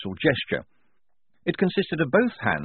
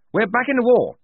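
loud, high rousing declaration; triumphant major; 14 semitones higher, 7 dB louder than usual. Acted. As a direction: proclaim it like a rallying cry.

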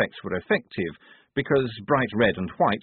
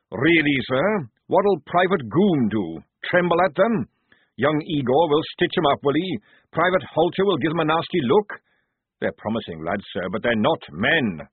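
raised, louder sergeant's voice, pleading sense; gruff old sergeant, protesting; 7 semitones higher, 5 dB louder than usual. A narrator's reading. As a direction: talk the officer out of it. Jackram mocking the plan.

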